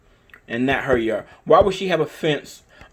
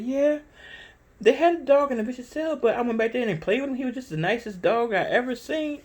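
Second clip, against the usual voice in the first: high, light imitation of the desk clerk; front desk lady; 10 semitones higher than usual. Desk clerk telling the owner the dog is nice, high and sweet. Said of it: "She said".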